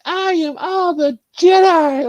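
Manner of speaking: high, slow grandiose declaration; mock heroic proclamation. Rey answering Palpatine, mock grandiose. Voicing Rey's grand reply.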